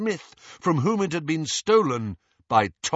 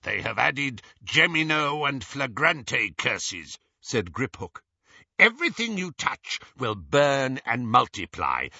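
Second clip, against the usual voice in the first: a hint of a curt goblin banker; a lightly voiced goblin, calm and dismissive. Griphook explains the curses.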